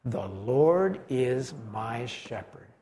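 low, soft and very slow; hushed, drawn-out recitation with even weight; slow led recitation. Shown as a demonstration. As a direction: lead a group through it word by word, quietly. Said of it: "say that with me".

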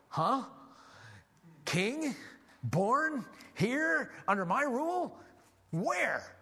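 shocked Herod. Acted. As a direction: Herod jolted by news of a rival king. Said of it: "And Herod goes".

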